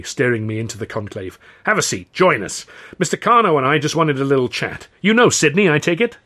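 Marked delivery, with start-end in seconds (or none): 1.6-6.2 genial host. A narrator's reading. Considine's voice.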